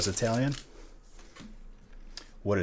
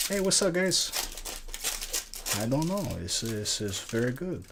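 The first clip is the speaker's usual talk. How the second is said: high, singsong Italian accent impression; hammed-up Italian accent greeting the chat; heavy Italian accent; 5 semitones higher than usual. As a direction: do a big cartoonish Italian accent. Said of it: "talks in a very heavy Italian accent".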